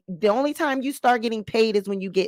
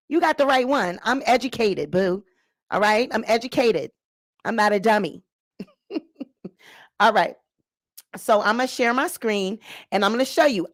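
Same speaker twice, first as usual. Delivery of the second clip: ordinary talk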